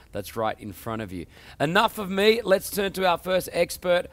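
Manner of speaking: high, loud show-opening delivery; punchy, energetic host intro hyping the show; upbeat TV presenter. Acted. Host welcomes viewers to the tech show, upbeat presenter.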